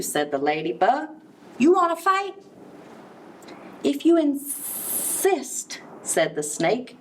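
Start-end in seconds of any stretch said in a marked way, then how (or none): 1.6-2.3 high, pushy challenge; high-pitched cocky bug; picking a fight. A narrator's reading. Grouchy ladybug picks a fight. 3.8-5.8 slow, drawn-out hissing snake; slow sly snake voice. A narrator's reading. Snake answers slowly.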